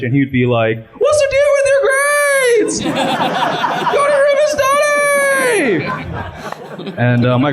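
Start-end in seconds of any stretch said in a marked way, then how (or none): 1.0-5.8 much higher, slow sitcom-style shouting rant; Chinese Jerry Seinfeld. Retold or imitated. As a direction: yell it like a sitcom comedian dad. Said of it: "he kinda sounds like Chinese Jerry Seinfeld".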